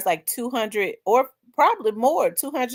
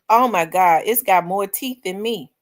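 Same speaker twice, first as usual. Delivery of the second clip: ordinary delivery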